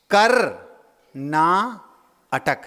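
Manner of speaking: "कर", "ना", "अटक" each separate and slow; three slow pieces: "कर" "ना" "अटक"; slow "कर ना अटक", pausing after each part; split into parts. Shown as a demonstration. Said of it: "एक तीसरे तरीके से तोड़ेंगे".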